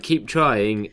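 upbeat encouragement. Retold or imitated. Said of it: "they were like".

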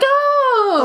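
high, loud delighted "Oh!"; delighted surprise. Retold or imitated. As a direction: gasp with happy surprise. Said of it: "and you're like".

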